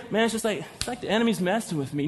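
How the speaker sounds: soft, offhand mumbly whine; mock casual complaint. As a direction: toss it off as no big thing, imitating. Mimics Christians playing down the enemy.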